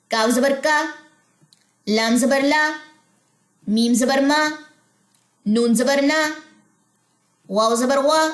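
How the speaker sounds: careful letter-by-letter recitation; teacher spelling out letters for the class; spelling drill. Shown as a demonstration.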